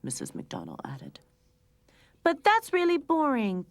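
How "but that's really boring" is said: high, loud whiny complaint; complaining. A narrator's reading. Young cat Lucy complaining to her brother, whiny. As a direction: whine it like a bored kid. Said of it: "Lucy complained".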